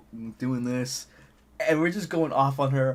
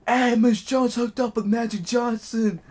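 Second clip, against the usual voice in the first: high, raised-voice announcement; imitated yell; 8 semitones higher, 4 dB louder than usual. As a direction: holler it down the hallway like a kid. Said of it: "Kev just yells out loud".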